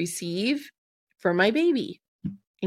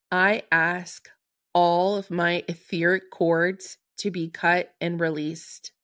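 spoken invocation. Acted. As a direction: let each line land with long pauses, like casting a spell. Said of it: "I'm gonna say this 'cause it's really beautiful".

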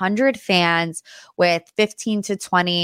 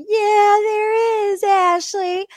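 teasing crowd; 12 semitones higher, much slower than usual. Retold or imitated. Audience calling out Ashley, high teasing sing-song. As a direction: tease her like the whole crowd.

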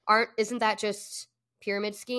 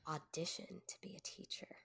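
whispering; 3 semitones lower, 19 dB quieter than usual. Real, not acted. Repeating friend's phrase, amused.

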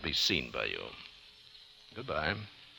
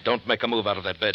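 threatening command; 4 semitones higher, 5 dB louder, faster than usual. Acted. Gunman threatens man in bed.

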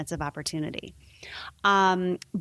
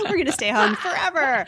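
mock resigned parent; 7 semitones higher, 5 dB louder than usual. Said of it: "mocking staying home".

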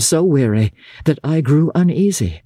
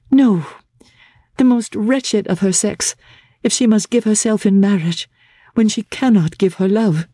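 put-on higher young woman's voice, spirited and pained; a young lady refusing with sudden spirit; raised, earnest voice of a distressed bride; Laura protesting; 8 semitones higher than usual.

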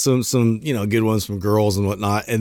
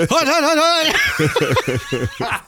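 slow, high, laughing hut calls; drawn-out squealed snap count; super excited; much slower than usual. Retold or imitated. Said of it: "super excited".

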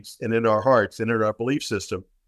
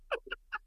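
short laugh; 16 dB quieter than usual. Real, not acted. Laughs after his own punchline.

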